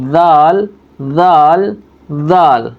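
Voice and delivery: "ذال" with the tongue between lightly pressed teeth; letter demo. Shown as a demonstration. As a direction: voice "ذال" as a buzzing th. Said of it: "دانتوں کے بیچ میں بولیں گے".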